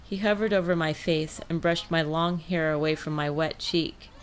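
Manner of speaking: plain reading voice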